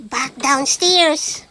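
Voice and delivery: plain reading voice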